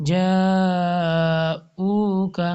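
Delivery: جاؤک drawn out very slowly; Quran recitation. Shown as a demonstration. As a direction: stretch جاؤک carefully. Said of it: "اور next ہے". (جاؤک آہستہ تلاوت)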